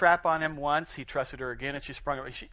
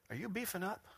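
soft, higher mock flirt; put-on admiring voice, much softer; flirty flattery; 6 semitones higher, 10 dB quieter than usual. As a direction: coo it at the guy, hushed and raised. Acts out lady flattering a guy.

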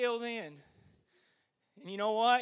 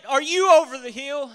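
pressing question; 3 semitones higher, 12 dB louder than usual. Acted. Preacher asking the congregation again, loud and pressing. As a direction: drive the question home to the pews. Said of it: "I'm gonna ask you again tonight".

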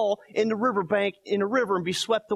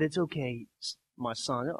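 soft permissive father; 7 semitones lower, 7 dB quieter than usual. Pretend. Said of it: "if I said".

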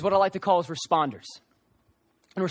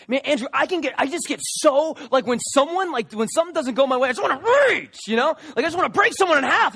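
mock rage; 6 semitones higher, 4 dB louder than usual. Hot-tempered listener voiced.

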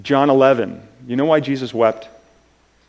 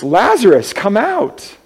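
higher and louder, a commanding call; shouting; 8 semitones higher, 6 dB louder, slower than usual. Retold or imitated. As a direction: call Lazarus out of the tomb. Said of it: "As he shouts".

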